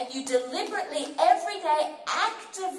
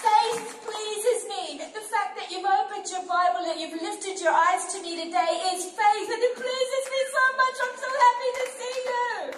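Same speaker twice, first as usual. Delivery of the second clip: joyful, lifted, almost sung; delighted; 6 semitones higher, 3 dB louder than usual. Pretend. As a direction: speak as God beaming with delight. God voiced with delight.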